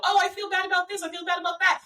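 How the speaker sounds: high-pitched mock confession; imitating fellow drivers. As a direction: parrot their complaints up high. Voicing fellow drivers confessing feelings.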